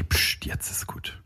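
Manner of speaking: whispered psst, slow; shushing. Shown as a demonstration. Showing how to end an awkward chat, whispering. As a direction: hush them in a whisper. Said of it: "dann sagst du, okay".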